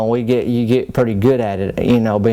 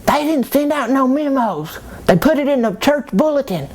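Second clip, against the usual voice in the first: much higher put-on church lady voice; mock Mary voice. Mary correcting Joseph about the bulletin, in a high prim voice. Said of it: "she give me a look like".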